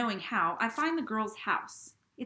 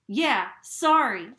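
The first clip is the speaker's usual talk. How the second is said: slow, much louder warning; 3 semitones higher, 12 dB louder, much slower than usual. Acted. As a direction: drag out each word as a threat. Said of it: "I warn".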